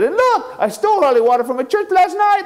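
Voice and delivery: high excited boasting voice; Shorty bragging in a high voice. Acted. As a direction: show off the stolen prize. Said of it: "She said".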